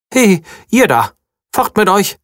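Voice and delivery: high bunny voice calling out. A narrator's reading. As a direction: shoo them off like a tiny guard. Unseen voice shouts warning.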